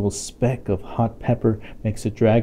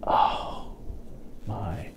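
hushed awe; 9 dB quieter, much slower than usual. Acted. Awe at the disaster.

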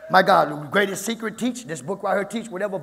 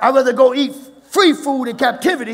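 raised, loud preacher's shout; fired-up preaching; 5 semitones higher, 10 dB louder than usual. Real, not acted. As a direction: punch it out to the congregation. Preacher drives the point home loudly.

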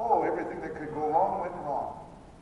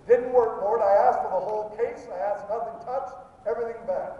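complaint; 5 semitones higher, 8 dB louder than usual. Acted. Acts out complaint to the Lord.

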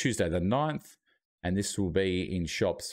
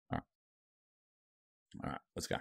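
whispering; 6 semitones lower, 11 dB quieter, much slower than usual. Real, not acted. Quietly starting to eat on stream.

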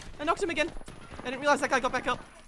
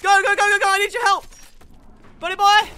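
high, loud cry for help; urgent shouting; 8 semitones higher, 11 dB louder, faster than usual. Real, not acted. Player begging teammate for help, urgent shouting. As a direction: yell for your teammate, desperately.

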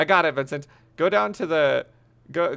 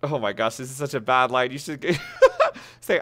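normal talking voice

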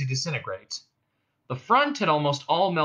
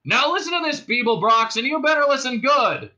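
high, loud warning; shouted order; 11 semitones higher, 6 dB louder than usual. A narrator's reading. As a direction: shout for his full attention. Cop shouts orders at Zaphod.

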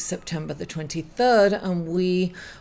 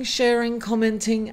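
ordinary talk

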